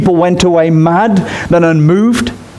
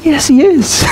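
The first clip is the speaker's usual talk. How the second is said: emphatic retort; 11 semitones higher, slower than usual. Real, not acted. Rebutting "not my president.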